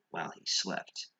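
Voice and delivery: ordinary delivery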